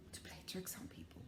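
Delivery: sneaky whisper. Acted. Teacher whispering about the dad's tricks. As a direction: whisper it like a mischief secret.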